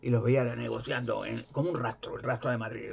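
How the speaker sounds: normal talking voice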